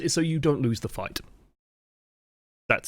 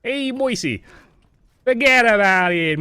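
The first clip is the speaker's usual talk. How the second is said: high, slow, hammed-up New York mobster, louder on forget about it; New York gangster accent; 9 semitones higher, 5 dB louder, slower than usual. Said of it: "I can't do a New York accent".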